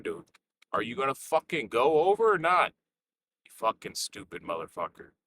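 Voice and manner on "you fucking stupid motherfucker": usual speaking voice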